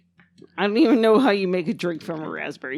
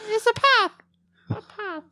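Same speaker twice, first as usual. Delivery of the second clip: squeaky raised pitch, then fading; high-pitched silly voice. Retold or imitated. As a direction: pipe it up high like a cartoon. Monty Python quote, high voice.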